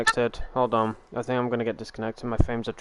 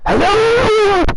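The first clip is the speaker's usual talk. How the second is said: sudden shouted greeting, much higher and louder; loud hello; 13 dB louder than usual. Retold or imitated. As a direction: blast a high loud hello into the mic. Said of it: "and then I was like".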